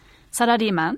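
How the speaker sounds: サラリーマン in Japanese syllables, "sa-ra-rii-man"; Japanese English. Shown as a demonstration. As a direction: pronounce サラリーマン as a Japanese word, tapped r. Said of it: "it's actually Japanese English". (サラリーマンを日本語の発音で)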